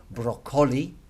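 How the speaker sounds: "broccoli" stressed on the middle, bro-COL-i; "broccoli" as bro-COL-i, deliberately misplaced stress; wrong stress. Shown as a demonstration. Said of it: "is not gonna be".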